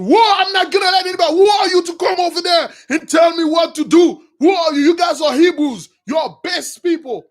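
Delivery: high, loud, fast outraged retort; indignant king. Retold or imitated. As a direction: cut him off in scornful anger. King interrupts Aaron angrily.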